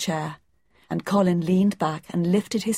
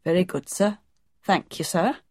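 lightly voiced gardener. A narrator's reading. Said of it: "said Mister Roach".

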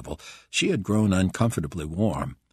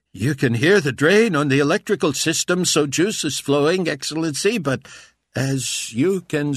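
higher, louder anxious mechanic; nervous mechanic; 9 semitones higher, 6 dB louder than usual. A narrator's reading. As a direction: explain nervously to the boss. Mechanic explains to Carpathia.

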